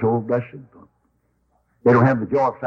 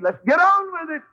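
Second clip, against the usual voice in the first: high, brisk, impatient quote; like Jesus brushing off the delay, let's go; eager impatience. Retold or imitated. Preacher voicing Jesus eager to reach Calvary, high impatient. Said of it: "I think Jesus' attitude".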